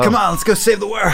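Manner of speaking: high, loud heroic rallying cry; young hero voice. Retold or imitated. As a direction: call everyone to adventure. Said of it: "being cast as".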